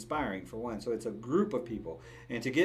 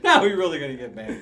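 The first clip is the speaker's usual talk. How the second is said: laughing; 12 dB louder than usual. Real, not acted. Laughing at own joke.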